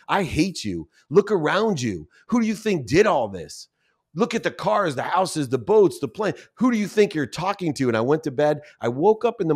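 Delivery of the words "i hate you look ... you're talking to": higher-pitched, indignant retold rant; a mock-indignant boast, pitched up; indignant outburst. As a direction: rant at her, proud and offended. Retold fight with wife.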